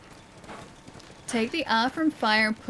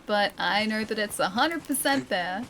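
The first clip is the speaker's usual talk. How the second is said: normal talking voice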